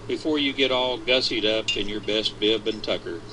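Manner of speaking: usual speaking voice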